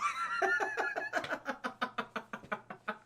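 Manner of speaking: squealing high laugh. Real, not acted. Laughing at own retelling.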